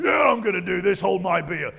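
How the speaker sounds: loud, brash boast; cocky shout. Retold or imitated. David boasting loudly before the giant. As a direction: shout it like a reckless show-off. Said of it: "He's like".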